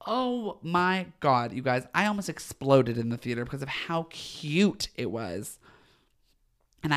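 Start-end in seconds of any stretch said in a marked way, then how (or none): none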